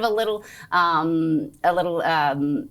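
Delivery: real false starts and repeated words; stumbling over words. Real, not acted. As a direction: trip over the start of the sentence, restarting with ums. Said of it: "I'm sort of stuttering here".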